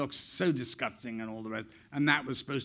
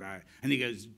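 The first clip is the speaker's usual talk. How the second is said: normal talking voice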